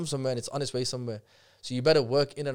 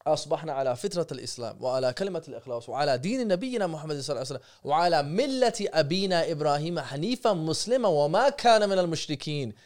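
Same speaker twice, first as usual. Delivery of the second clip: recited affirmation; 3 semitones higher, much faster than usual. Real, not acted. Reciting the morning affirmation to his podcast host, fast and rhythmic. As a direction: rattle the morning dua off fast from memory. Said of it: "these are the affirmations".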